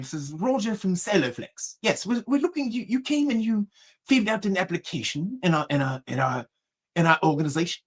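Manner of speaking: soft put-on company-caller voice; pretend executive. Prank caller telling a job seeker about his application, pretend executive. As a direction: play a hesitant fake executive. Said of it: "pretended to be an executive".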